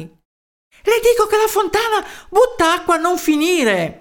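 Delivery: high, urgent insisting; excited insistence. A narrator's reading. Townsman convincing the doubting mayor, high excited voice. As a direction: insist at a high pitch on the news.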